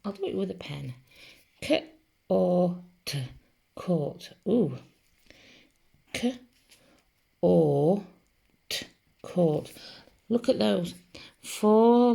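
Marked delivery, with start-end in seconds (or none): 1.6-3.2 K, O, T as separate phonics sounds; isolated sounds with short gaps, K then O then T; sounding out. Shown as a demonstration. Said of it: "let's sound that one out". 6.1-8.9 K, a long O, then T, each on its own; sounding out. Shown as a demonstration. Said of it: "let's sound that one out".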